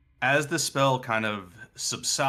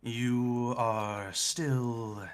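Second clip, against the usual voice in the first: very slow, drawn-out verdict; ominous, measured judgement; eerie cultist figure; 4 dB quieter, much slower than usual. Acted. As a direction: pronounce it slowly, with a long pause. GM voices the cultist replying.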